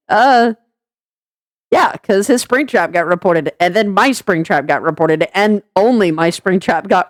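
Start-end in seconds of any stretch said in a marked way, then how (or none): none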